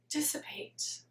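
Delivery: very soft, whispered question; fading whisper. Acted. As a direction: let the word vanish into breath. Word fades as boredom would.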